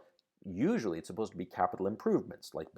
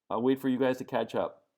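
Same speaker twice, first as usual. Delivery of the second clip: normal talking voice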